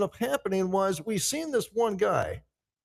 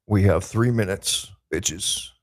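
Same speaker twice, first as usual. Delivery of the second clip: low mock voice; 9 semitones lower, 5 dB louder, slower than usual. Hamming up the countdown.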